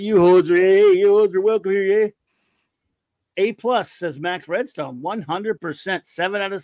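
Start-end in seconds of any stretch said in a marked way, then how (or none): 0.0-2.1 louder, hammed-up hoser greeting; mock Canadian accent. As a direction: lay on a jokey Canadian twang. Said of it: "It's called hoser".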